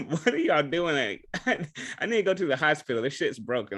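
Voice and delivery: high, loud laugh-filled retelling; laughing mock panic. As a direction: crack up while acting out the panic. Laughing retelling of injured brother.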